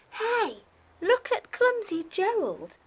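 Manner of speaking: high, taunting jeer; mocking animals. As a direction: tease him like playground bullies. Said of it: "the animals all laughed".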